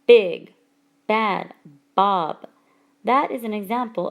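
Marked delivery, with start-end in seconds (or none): 0.1-2.5 "Big Bad Bob", one loud word at a time; stress on B sounds. Shown as a demonstration. Teacher showing where alliteration falls, stress on B sounds. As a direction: punch the B of "Big Bad Bob", pausing between words. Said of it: "the emphasis is on the B sound".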